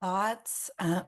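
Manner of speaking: losing her voice, rough and weak; hoarse voice. Real, not acted. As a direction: speak through a worn-out voice. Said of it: "lost my voice again".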